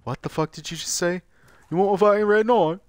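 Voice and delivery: put-on high, rowdy British accent; mock British merchant. Mocking a British friend as the game merchant, British accent. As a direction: play a pushy British merchant. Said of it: "this is what Tyler sounds like".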